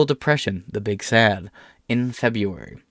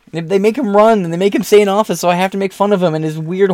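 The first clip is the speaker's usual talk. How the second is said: much higher, louder heated rant; 10 semitones higher, 5 dB louder than usual. Real, not acted. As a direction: fire back in a heated rant.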